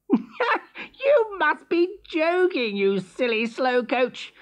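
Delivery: high, drawn-out sneer with a laugh; laughing, high-pitched mockery. A narrator's reading. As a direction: scoff like a cocky hare. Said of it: "sneered Hare".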